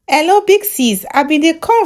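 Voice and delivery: high eager hello to the host; bright caller greeting. Acted. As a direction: greet the host like a fan on air.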